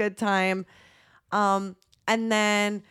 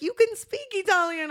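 surprised high exclaim; 10 semitones higher than usual. Retold or imitated. Guy surprised at her Italian.

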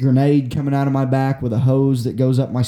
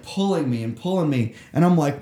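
usual speaking voice

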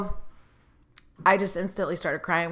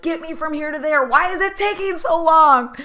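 whining to get there faster; impatient whine; 10 semitones higher, 7 dB louder than usual. Retold or imitated. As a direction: whine at being stuck waiting. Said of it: "it would just be like".

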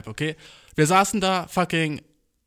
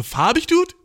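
much higher and louder squeal of disbelief; exaggerated disbelief; 14 semitones higher, 5 dB louder, slower than usual. What he might have said to the boy, loud high disbelief. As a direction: overdo the shock. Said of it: "Das wär krass, hätte ich dann noch gesagt".

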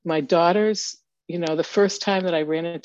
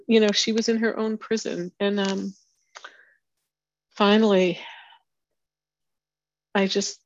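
normal talking voice

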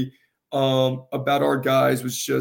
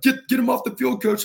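urgent referee; 10 semitones higher than usual. Retold or imitated. Referee retold urging the coach.

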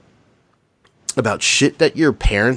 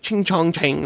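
fake Chinese counting; 7 semitones higher than usual. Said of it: "he thought it was Chinese".

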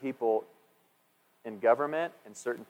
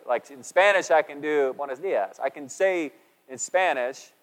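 normal talking voice

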